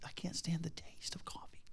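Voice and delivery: hushed whisper, slowed down; sheepish whisper about coffee; whispered confession. Real, not acted. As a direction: let it slip out as a whisper.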